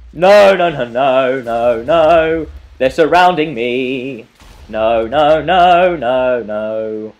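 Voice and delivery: loud, repeated panicked cries, drawn out; real alarm, belting out each word; panicked shouting. Real, not acted. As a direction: shout it as you get surrounded. Panics as zombies surround him.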